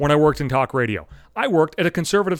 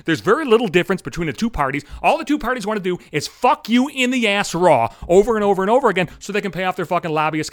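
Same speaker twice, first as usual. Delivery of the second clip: ordinary talk, nothing marked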